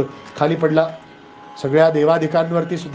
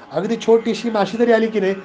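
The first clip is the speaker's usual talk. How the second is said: ordinary talk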